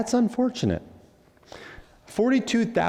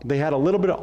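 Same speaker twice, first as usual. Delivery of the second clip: usual speaking voice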